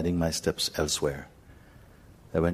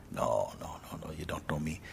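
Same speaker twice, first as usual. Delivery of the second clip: quiet denial; 9 dB quieter than usual. Retold or imitated. Denies knowing the stranger.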